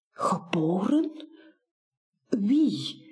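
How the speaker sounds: hushed breathy whisper. Acted. Buur whispers back to Baas in astonishment, hushed whisper. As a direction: whisper it back, barely voiced. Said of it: "fluistert Buur terug".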